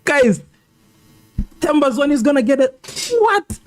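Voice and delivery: high, urgent and climbing to a squeal on what; real alarm at a possible red card; rising alarm. Real, not acted. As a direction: pitch shooting up in disbelief. Possible red card for Themba Zwane.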